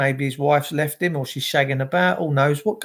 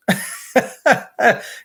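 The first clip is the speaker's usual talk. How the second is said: short airy laugh rising up; breathy laugh; 7 semitones higher than usual. Real, not acted. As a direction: laugh out at the funny bit. Laughing at mother-in-law story.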